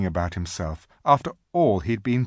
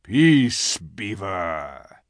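growled lion's rebuke, falling away; very low growl; 4 dB louder, much slower than usual. A narrator's reading. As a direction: silence him with a low growl. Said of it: "with a very low growl".

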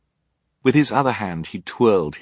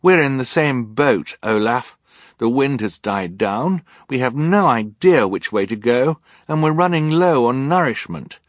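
put-on high, insistent girl's voice; urgent reasoning; 6 semitones higher, 4 dB louder than usual. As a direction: press each point on a stubborn adult. Violet argues urgently with Olaf.

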